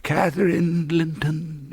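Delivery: put-on high, slow, shivering child ghost. A narrator's reading. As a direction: say the name slowly, trembling with cold. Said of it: "it replied shiveringly".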